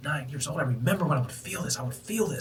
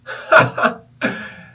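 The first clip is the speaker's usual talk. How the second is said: high breathy otherworldly laugh; high airy laugh; 11 semitones higher, 11 dB louder than usual. Retold or imitated. The universe laughs at his question, high airy laugh. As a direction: laugh lightly as the amused universe.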